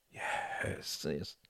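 faint, voiceless whisper. A narrator's reading. Beaten Reginald answering the huge man, whispering. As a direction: whisper it with hardly any sound. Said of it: "whispered Reginald".

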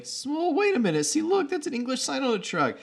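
very high, strained film-character voice; John Travolta. Retold or imitated. Imitating Travolta's reaction in film.

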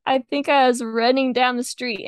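ordinary talk

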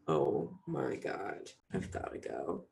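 mock panic. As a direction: drag it out low and hushed like a dramatic exit. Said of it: "I'm like".

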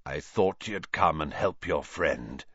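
low, hoarse villain voice; rusty, low croak. A narrator's reading. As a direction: speak from a long-unused throat. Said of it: "he said hoarsely".

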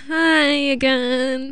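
put-on stretched, unwilling hi to the persistent old man; reluctant greeting. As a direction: greet him again with obvious reluctance. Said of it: "I was like".

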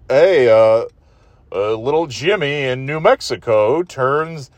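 mock radio host birthday read; lightly voiced DJ patter; mimicking the DJ. Complaining about the kids' radio host, mock announcer read. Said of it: "the guy does the same thing".

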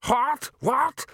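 very high, outraged screech; high-pitched scream of outrage. A narrator's reading. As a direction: shriek it in pained disbelief. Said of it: "screamed Downey".

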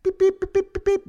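high, loud clipped beeps imitating telegraph; Morse code beeps. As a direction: mimic a Morse code signal with your voice. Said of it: "the Morse code thing".

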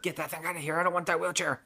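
high-pitched retold protest; play-acted indignation; mock angry refusal. Acts her angrily refusing the wheelchair.

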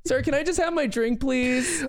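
high whiny customer; impatient customer. Pretend. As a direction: plead for the drink.

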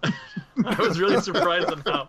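amused, slowed speech breaking into laughter; laughing. Real, not acted. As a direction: laugh it out while talking. Laughs while praising Shazam.

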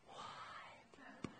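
ordinary talk

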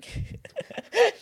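very high laugh after the punchline; high squeal of laughter. Real, not acted.

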